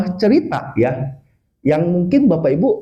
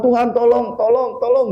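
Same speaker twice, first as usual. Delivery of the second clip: higher-pitched mock plea; pleading prayer; 5 semitones higher than usual. Grandmother to God on the flooded roof, mock pleading. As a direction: imitate granny praying for help. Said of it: "Lalu dia berdoa".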